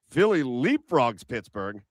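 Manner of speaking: ordinary delivery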